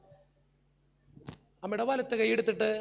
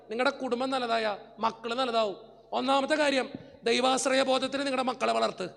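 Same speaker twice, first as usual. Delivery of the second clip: ordinary delivery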